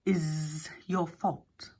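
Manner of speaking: slow, acid whisper of a snake. A narrator's reading. Snake Hex sneering at the ram, slow acid whisper. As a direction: hiss each word slowly with contempt. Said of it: "said Hex acidly".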